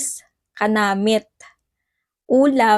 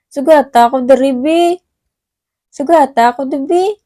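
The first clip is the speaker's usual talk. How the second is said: louder, sweetly coaxing request; coaxing lambing; 3 semitones higher, 7 dB louder than usual. Shown as a demonstration. Asking boyfriend to fetch her, louder coaxing plea. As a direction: wheedle him to pick you up, louder. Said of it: "papalambing ka para sunduin".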